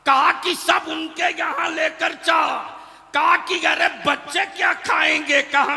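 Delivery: loud, high, ringing sermon delivery; full-force preaching. Real, not acted. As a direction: preach it out to a big crowd. Tells story in preaching force. (पूरे जोश में तक़रीर)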